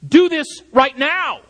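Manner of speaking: high, slow, emphatic order; urgent exhortation. Shown as a demonstration. Preacher demanding immediate action from the congregation, urgent exhortation. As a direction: press every word as a demand for action. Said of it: "It's an exhortation to immediate action".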